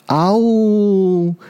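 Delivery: high, drawn-out 'ao-woo' howl; storyteller's voiced roar, held long; dinosaur roar. A narrator's reading. Angry tyrannosaur roars. (恐龙拉长声嗷呜吼叫)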